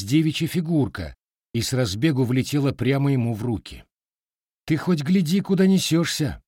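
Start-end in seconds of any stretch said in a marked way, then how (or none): 4.6-6.4 grumbling. A narrator's reading. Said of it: "проворчал Матвей".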